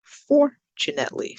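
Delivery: fortunately with FOR high and punched; teacher's demo of FOR-tunately; stress on first syllable. Shown as a demonstration. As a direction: jump up on FOR in fortunately. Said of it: "that's on the first sound or syllable".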